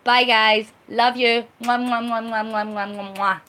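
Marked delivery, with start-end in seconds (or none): 1.6-3.4 between kisses. Real, not acted. Blowing kisses goodbye.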